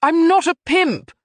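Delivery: loud, high indignant retort; sharp. A narrator's reading. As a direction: snap back in outrage. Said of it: "Marcus said sharply".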